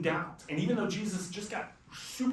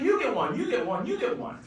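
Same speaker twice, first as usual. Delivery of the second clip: Oprah giveaway; 3 semitones higher than usual. Retold or imitated. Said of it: "the Oprah of miracles".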